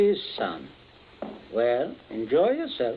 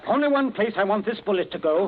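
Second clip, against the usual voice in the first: menacing threat; 6 semitones higher, 5 dB louder, faster than usual. Acted. Threat at gunpoint.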